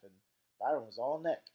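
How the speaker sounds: ordinary delivery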